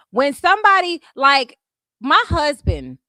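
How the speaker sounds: loud and high, insistent; heated insistence; emphatic. Real, not acted. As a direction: raise your voice to make the point. Emphatic lecture.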